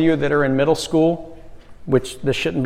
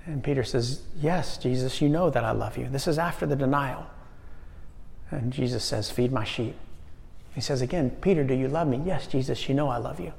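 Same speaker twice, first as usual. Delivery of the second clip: soft, low, gentle voice; tender retelling; 3 semitones lower, 9 dB quieter than usual. Acted. Preacher retelling Jesus asking Peter, softly. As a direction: let it settle softly over the room.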